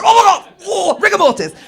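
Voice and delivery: loud, high horrified outburst; shocked exclamation. Retold or imitated. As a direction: yelp it in mock panic. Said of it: "people go".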